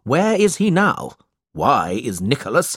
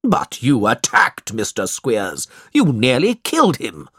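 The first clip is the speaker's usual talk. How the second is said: restrained angry shout; 2 semitones higher than usual. A narrator's reading. Uncle accusing Nicholas of attacking Squeers, restrained shout. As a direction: accuse him hotly in a lightly voiced uncle's tone. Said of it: "Ralph shouted".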